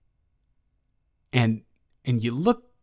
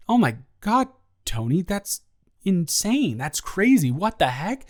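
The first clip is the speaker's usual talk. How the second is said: shocked outburst; 6 semitones higher than usual. Retold or imitated. Shocked reply to Tony's confession.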